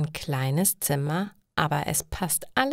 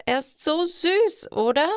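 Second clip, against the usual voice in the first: put-on swooning, much higher, over a cute boy; gushing; 12 semitones higher, 4 dB louder than usual. A narrator's reading. Girl gushing to her friend about Jakob, swooning high. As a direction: gush about the boy, pitch soaring. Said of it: "redet sie sehr viel".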